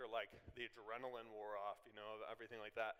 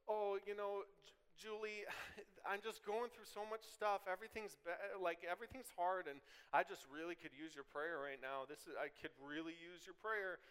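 higher, mock-whiny plea for prayer; 5 semitones higher than usual. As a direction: play the overwhelmed friend, pitched up. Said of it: "It's to go".

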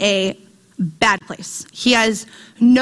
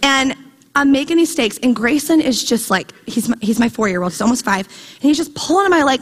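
ordinary talk, nothing marked

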